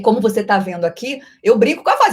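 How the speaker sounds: ordinary talk, nothing marked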